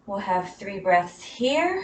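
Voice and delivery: ordinary talk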